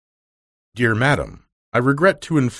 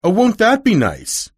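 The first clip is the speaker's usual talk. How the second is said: much higher, eager cry of joy; delighted cry; 7 semitones higher, 3 dB louder than usual. Acted. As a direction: burst out with happy surprise. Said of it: "cried Nancy".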